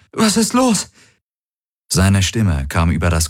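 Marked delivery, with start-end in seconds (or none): 0.1-0.9 high, alarmed question; startled. A narrator's reading. Kirai asking the pilot about the alarm, startled. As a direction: ask it in a fright, pitched way up. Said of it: "rief sie erschrocken".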